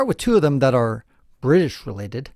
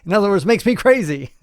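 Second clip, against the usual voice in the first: laughing; 7 semitones higher, 6 dB louder than usual. Real, not acted.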